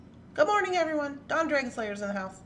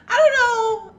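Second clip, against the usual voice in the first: loud, high wail of confusion; exasperated outburst; 13 semitones higher, 13 dB louder than usual. Real, not acted. Streamer losing track of the date, exasperated wail. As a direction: throw it out high and loud, helplessly.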